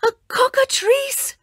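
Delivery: high, frightened cry; scream. Acted. Bela screams as the snake appears, high scared cry. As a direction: gasp it out in terror. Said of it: "she screamed".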